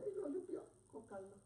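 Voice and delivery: ordinary talk, nothing marked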